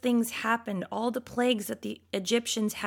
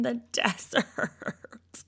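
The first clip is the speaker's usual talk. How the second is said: laughing through the word; 3 dB quieter, much slower than usual. Real, not acted. Reading Israelites' complaint, laughing.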